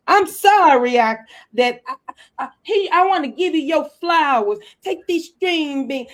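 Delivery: mocking the husband's apology with flowers and food. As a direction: overplay a desperate husband offering gifts. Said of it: "You do your parts, brother".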